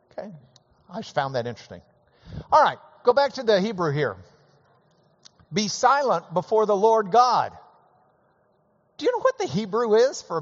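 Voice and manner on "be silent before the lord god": loud, high proclaiming read; scripture reading. Acted. Preacher reading the verse loudly to the congregation.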